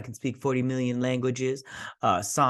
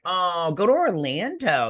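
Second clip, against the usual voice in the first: mock enthusiasm; 6 semitones higher, 4 dB louder, slower than usual. Mock advice to the characters.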